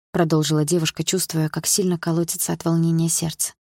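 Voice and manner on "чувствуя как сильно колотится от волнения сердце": ordinary delivery, nothing marked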